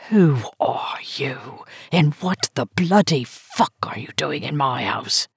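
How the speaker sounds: put-on gravelled, slurred old drunk woman menacing an intruder; a drunk old woman challenging a stranger in her dark hallway, low, slurred and ferocious. A narrator's reading. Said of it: "Her voice was low, graveled, slurred, ferocious".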